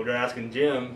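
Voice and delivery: ordinary talk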